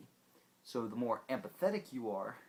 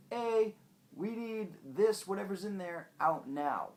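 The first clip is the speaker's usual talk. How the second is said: shouted, higher urgent nerve voice; nerves calling brain; 9 semitones higher, 6 dB louder than usual. Acted. Nerves yelling an urgent demand at the brain. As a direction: yell it like alarmed nerves. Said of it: "tell the brain".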